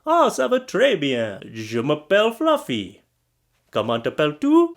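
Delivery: French phrasebook dialogue with lifted questions; schoolroom French. Shown as a demonstration. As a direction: recite beginner French like a class exercise. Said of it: "I can say some stuff in".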